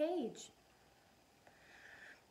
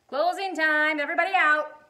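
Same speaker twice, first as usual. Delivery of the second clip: loud manager's call; 7 semitones higher, 13 dB louder than usual. Acted. Manager calls out to the whole laundromat, loud. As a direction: bellow it across the laundromat. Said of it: "the manager of the laundromat called".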